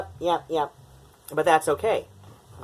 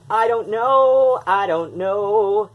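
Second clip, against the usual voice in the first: loud, slow, higher I don't know; exasperated drawl; 7 semitones higher, 6 dB louder, slower than usual. Real, not acted. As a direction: stretch it out in mock despair. Mock frustration at lost word.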